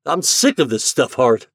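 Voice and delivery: loud furious outburst; exploding in anger. Acted. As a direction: let it burst out of him. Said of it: "he exploded".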